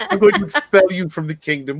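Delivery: high, laughing royal decree; mock regal threat. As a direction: banish them like a hammed-up monarch, amused. Mock regal threat, parodying the character.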